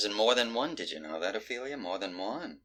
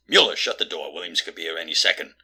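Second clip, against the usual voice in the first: ordinary talk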